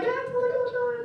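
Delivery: squeaky high embarrassed apology; flustered reply. Retold or imitated. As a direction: ex asks if he's okay, high flustered reply.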